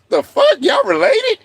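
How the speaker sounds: high-pitched incredulous question; mock disbelief. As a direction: act out a stunned onlooker. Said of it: "he'd be like".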